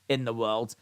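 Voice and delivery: usual speaking voice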